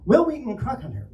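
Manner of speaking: high, dramatic hammed-up title reading; William Shatner. Shown as a demonstration. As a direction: announce it like a hammy space captain. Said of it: "in a highly Shatner-esque fashion".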